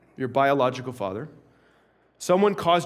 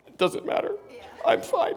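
mock choked-up voice, barely voiced; imitating holding back tears; 13 semitones higher, 3 dB quieter than usual. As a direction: act like a boy trying not to cry. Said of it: "boys are trying not to cry".

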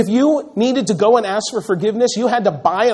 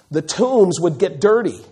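normal talking voice

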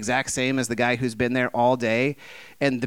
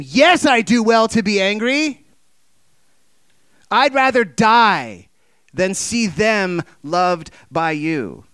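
loud, raised, slow furious shout; screamed defiance; 7 semitones higher, 7 dB louder, slower than usual. Retold or imitated. Jonah screams back at God in anger, furious shout. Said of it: "Jonah screams back at him".

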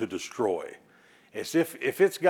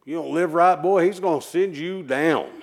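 higher and louder, threatening fire-and-brimstone warning; imitating a stern preacher threatening a boy with hell; mock hellfire preacher; 6 semitones higher, 6 dB louder than usual. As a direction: scare the boy like an old revival preacher. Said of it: "all I knew of God was".

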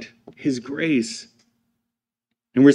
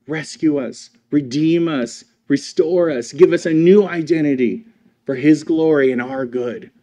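usual speaking voice

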